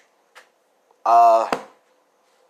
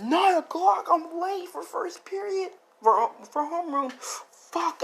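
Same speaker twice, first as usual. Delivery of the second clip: very high, squeaky panic; acted frantic disbelief, pitched way up; panicked; slower than usual. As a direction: blurt it like you've badly overslept. Voicing panic at being late.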